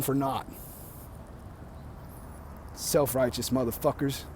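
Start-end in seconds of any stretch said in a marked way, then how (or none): 2.7-4.2 pissy mutter. Real, not acted. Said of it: "Oh, I'm just pissy".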